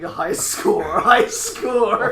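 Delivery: laughing mock victory yell. A narrator's reading. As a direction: chant it out loudly through laughter. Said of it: "and yelling".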